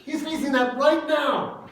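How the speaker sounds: normal talking voice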